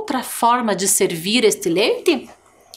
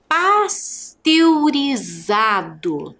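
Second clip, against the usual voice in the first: pasteurizado stretched out syllable by syllable; slow syllables; much slower than usual. Shown as a demonstration. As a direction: drag the word out for dictation. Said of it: "registre aí a palavra pasteurizado". (bem devagar, sílaba por sílaba)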